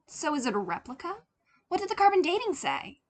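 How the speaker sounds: fast, high, urgent questions; fast, high eager demand. Acted. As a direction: press them eagerly, quickly. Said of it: "Tim demanded".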